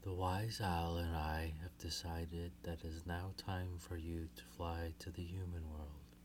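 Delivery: soft, hushed reassuring mother; comforting. Acted. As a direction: gently comfort her daughters. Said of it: "in a soft, comforting tone".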